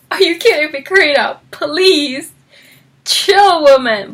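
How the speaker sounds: mock-fed-up reader's outburst; exasperated scolding. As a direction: tell her off, pitching up in disbelief.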